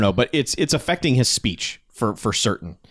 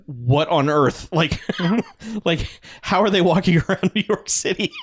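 laughing disbelief; 6 semitones higher than usual. Retold or imitated. Recalling the henchmen, laughing in disbelief. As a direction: laugh through the whole question.

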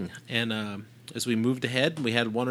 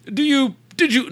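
put-on English accent; 12 semitones higher, 4 dB louder than usual. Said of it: "do the jolly old England accent".

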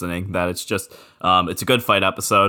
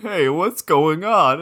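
high, slow mock-puzzled call; lightly voiced Connie, slow and higher; imitating Connie; 9 semitones higher, slower than usual. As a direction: play the confused soldier, drawn out. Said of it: "Connie's reaction when he's like".

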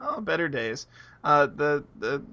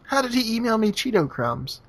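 mock baffled voice; 8 semitones higher than usual. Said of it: "he was like".

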